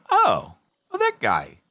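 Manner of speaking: ordinary talk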